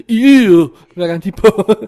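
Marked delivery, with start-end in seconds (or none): 0.1-0.5 high, loud recoiling "eee"; mock squirm of revulsion; creeped-out squeal. Viewer seeing creepy computer-generated characters, creeped-out squeal. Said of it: "hvor man ser sådan".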